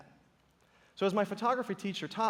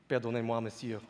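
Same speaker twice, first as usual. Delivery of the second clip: mock French rooster; 6 semitones lower than usual. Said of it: "And in France, they say".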